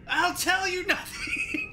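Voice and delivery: high, slow shrieking defiance; shrieking prisoner. Acted. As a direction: scream it out while being tickled. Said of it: "He shrieks and kicks and screams".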